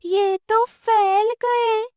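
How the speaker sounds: put-on high, slow, amazed child; astonished. Boy sees the shop grow huge, high and astonished. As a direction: stretch it out in wonder. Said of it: "Monu हैरानी से कहता है".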